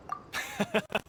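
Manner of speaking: a quick airless chuckle; breathy chuckle. Acted. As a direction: chuckle to yourself, pleased. Laughs mid boasting story.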